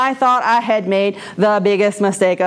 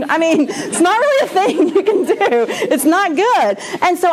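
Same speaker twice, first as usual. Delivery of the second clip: laughing; 8 semitones higher than usual. Real, not acted. Laughs at own story.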